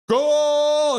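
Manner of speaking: long, high, loud "goal"; full-voiced playful holler; acted goal shout. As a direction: celebrate like a goal announcer. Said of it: "Muhammad's like".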